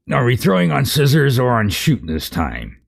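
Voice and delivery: high, puzzled giant voice; dim giant. Acted. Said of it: "said one giant".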